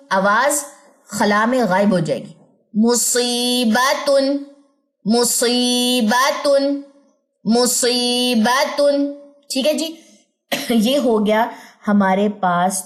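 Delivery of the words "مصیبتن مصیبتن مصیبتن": مصیبتن with the ی drawn out slowly; long vowel. Shown as a demonstration. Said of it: "دو second". (آہستہ مد کے ساتھ مصیبتن)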